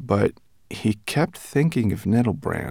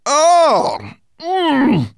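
high, loud "oh" and "mm"; appreciative moan; 6 dB louder than usual. A narrator's reading. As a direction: savour the taste aloud.

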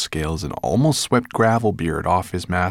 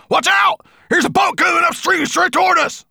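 very high shouted warning; crying out; 6 dB louder than usual. A narrator's reading. Dwarf warning dragon of approaching boat, crying out. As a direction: shriek a warning to your master. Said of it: "the dwarf suddenly cried".

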